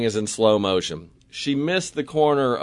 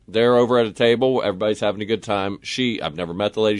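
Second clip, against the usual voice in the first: ordinary delivery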